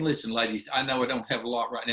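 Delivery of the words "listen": normal talking voice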